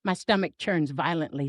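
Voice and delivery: ordinary delivery, nothing marked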